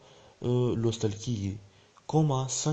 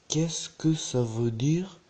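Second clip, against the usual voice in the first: very slow, higher, rising question; slow model pronunciation; 4 semitones higher, 4 dB louder, much slower than usual. Shown as a demonstration. Teacher drilling learners on a question, very slowly. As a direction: stretch it out and lift the end.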